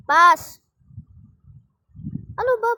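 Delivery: loud sharp call for the ball; shouting pass. Real, not acted. Gamer shouting at teammate to pass, loud. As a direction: bark for the pass.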